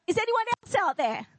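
high, quick call into the void; comic high-pitched shout-out; mock yelling out. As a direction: holler hopefully for someone else. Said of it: "then he yelled out".

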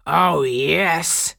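loud, jeering taunt; mocking. A narrator's reading. Pirates jeering at the girl they caught, loud and mocking. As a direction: throw it back at her mockingly. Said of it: "they mocked her".

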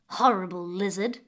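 put-on hissed, scornful girl. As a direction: spit the insult out with contempt. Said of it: "hissed the girl".

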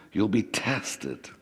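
ordinary delivery

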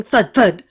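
two loud, heavy thuds; voiced bullet impacts; imitated impacts. A narrator's reading. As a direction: land each thud like a hit. Imitates rounds hitting a body.

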